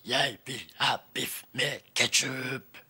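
very low, slow monster voice; a booming deep voice from a tiny girl; little girl speaks for the first time in a weird deep rumble; very strange voice. Acted. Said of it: "innmari rare stemmen hennes".

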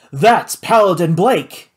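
slow, loud shout of a name; loud accusation. Acted. The judge denouncing Paladin to the room, shouting. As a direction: call him out to the room.

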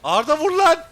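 yelling at a teammate in the heat of the fight; loud, urgent, high-pitched shout. Real, not acted. Urging teammate mid-fight.

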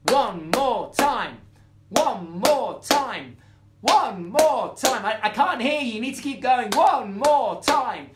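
put-on loud chant to the kids; loud call-out. Shown as a demonstration. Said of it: "if you'd like to hear it again, you have to do this".

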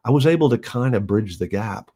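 ordinary delivery

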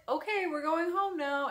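lightly acted cheery announcement to a child; mock-casual aunt. Aunt telling a preschooler it's home time, mock-casual.

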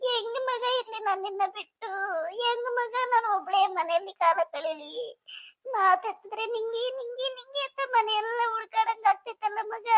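keening, weepy farewell; tearful wailing. Acted. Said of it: "ಚಿಕ್ಕಮ್ಮ, ಸಮಾಧಾನ ಮಾಡ್ಕೊಳ್ರಿ".

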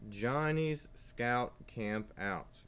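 put-on each word drawn out slowly for kids; slow title reading. Shown as a demonstration. Teacher reading a book title to young students, slow and clear. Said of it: "if you can read with me, do it".

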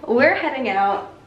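ordinary talk

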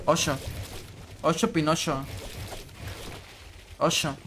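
ordinary talk

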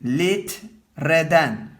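litreden stretched slowly; slow teacher's dictation of litreden; slow demo. Shown as a demonstration.

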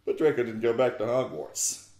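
plain reading voice

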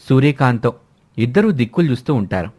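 usual speaking voice

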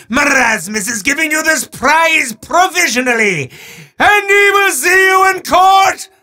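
grand, theatrical villain proclaiming to a mortal; booming mock-sinister showman, with quick legal asides; hammy demon host. Demon Marasmus handing a prize to a mortal, hammy menace.

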